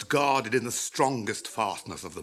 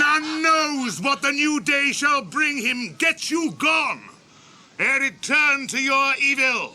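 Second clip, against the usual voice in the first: warning shout; 12 semitones higher, 5 dB louder than usual. Acted. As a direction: drive them off with a stern call. Aragorn warns the orcs.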